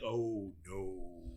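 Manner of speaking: a drawn-out, low show of disappointment; imitated dismay. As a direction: play the let-down listeners, lightly. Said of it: "they're like".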